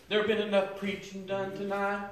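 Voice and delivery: ordinary talk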